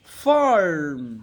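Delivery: ফার্ম drawn out with a curled r; slow rhotic model of ফার্ম; American pronunciation. Shown as a demonstration. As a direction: say ফার্ম as "faarrm", r clearly sounded. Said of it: "ওরা বলবে ফার্ম".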